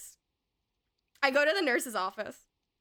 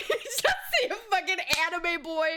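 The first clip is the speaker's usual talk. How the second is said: fast, laughing, pitched up; 6 semitones higher, faster than usual. Real, not acted. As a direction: laugh it out quickly. Laughing at punchline.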